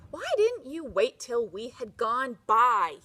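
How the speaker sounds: loud, gruff call across the road. Acted. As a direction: bark it at the girl with the geese. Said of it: "he called gruffly".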